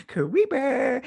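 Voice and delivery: hammed-up playground creep; creepy man voice. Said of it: "imagine him on a playground all like".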